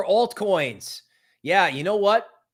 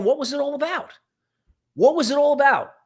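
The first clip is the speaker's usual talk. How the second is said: incredulous; 10 semitones higher, 3 dB louder, faster than usual. Real, not acted. High incredulous repeat.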